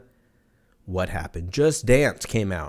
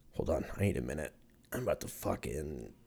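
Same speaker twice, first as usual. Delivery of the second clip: sucking in the pain, voice dropped low and much softer; real pain, barely getting words out; pained, hushed; 7 semitones lower, 15 dB quieter than usual. Real, not acted. Podcaster to listeners after stubbing a toe, real pained hush. Just kicked a table, in pain.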